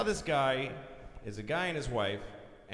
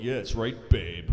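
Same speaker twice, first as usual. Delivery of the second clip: smug; 6 semitones lower than usual. Retold or imitated. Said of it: "he had this smug look like".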